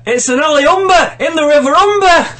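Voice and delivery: dramatic northern English yell; northern accent. Acted. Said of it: "in a northern accent".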